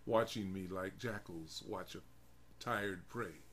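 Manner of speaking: usual speaking voice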